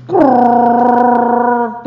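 long trilled krrr noise; drawn-out rolled "krrr"; rattling drone "krrr". Retold or imitated. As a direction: imitate a jangle of notes sounding all at once. Imitates all eight notes sounding at once.

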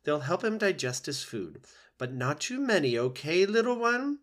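the goose comforting a gosling; kindly old goose. Acted. Loudwing reassuring.

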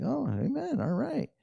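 high, soft pleased amen; surprised amen. Retold or imitated. Pastor reacting to the healing, high soft amen. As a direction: let the wonder come out softly. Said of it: "Who's more surprised than me?".

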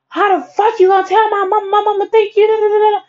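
high, loud mocking yell of Tasha; mimicking angry friend. Best friend yelling on the phone about her mom, mock angry.

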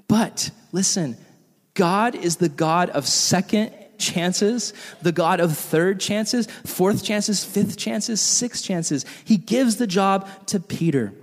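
slow, hushed sermon voice; tender preaching. Acted. Pastor telling church God gives chances, slow tender preaching. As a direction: ease into grace gently, slowly.

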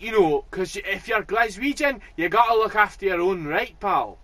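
mock Glaswegian, loud and proud; Glaswegian bloke. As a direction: preach Glasgow loyalty at him. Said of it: "he's like".

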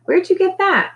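very high, excited question; squealed astonishment; amazed question. A narrator's reading. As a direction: ask it wide-eyed at a treat. Character amazed by marshmallow fluff.

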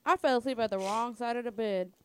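usual speaking voice

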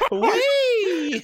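very high, loud, laughing whee; spinning in office chair. Pretend. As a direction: squeal like you're spinning round.

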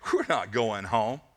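low, slow flat refusal; negative, dismissive. Acted. Naysayer answering the hopeful returnee, dismissive. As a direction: pour cold water on the hope, slowly. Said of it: "there were negative people there".

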